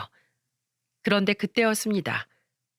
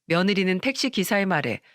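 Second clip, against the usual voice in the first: ordinary delivery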